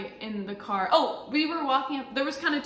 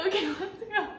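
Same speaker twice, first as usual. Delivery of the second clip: higher, tearful resolve; driving off after giving up the cat, tearful; 9 semitones higher than usual. Retold or imitated. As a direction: push through the tears to leave. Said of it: "eventually I'm like".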